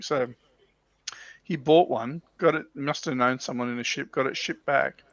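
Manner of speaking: usual speaking voice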